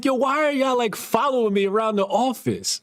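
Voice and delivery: high, exasperated challenge; annoyed question. Retold or imitated. Consultant to coworkers following him, high annoyed challenge. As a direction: call them out for tailing you.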